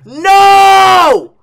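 high, loud anguished scream; real rage. Real, not acted. Gamer losing the climb, raging scream. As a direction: scream it as all progress is lost. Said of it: "this rage that you're hearing is real".

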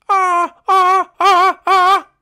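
very high, loud squeals; high whimpering cries. Acted. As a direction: squeal like a cornered recruit. Guilty recruit cornered by sergeant.

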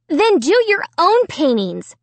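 high, indignant snap; snapping. A narrator's reading. Sister to little brother who touched her work, snapping. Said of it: "Sarah snapped".

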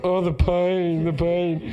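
slow, high hammed-up lament over "the pain"; mock agony. Teasing the friend with back pain, hammed-up mock agony. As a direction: ham up a suffering invalid.

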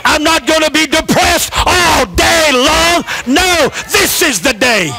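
rising, shouted preaching with long drawn lines; preaching at full force. Acted. Pastor rallying the congregation, preaching at full force.

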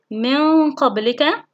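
slow من قبلك with long nasal noon; ikhfa demonstration. Shown as a demonstration. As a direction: stretch the hum from من into قبلك. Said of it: "وفي حالة كلمتين". (إخفاء النون بغنّة ممدودة في من قبلك)

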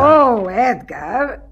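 very slow, drawn-out despairing call; exasperated sigh. Acted. Lady Hurf despairing of her husband. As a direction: drag his name out in despair.

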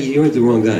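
ordinary talk, nothing marked

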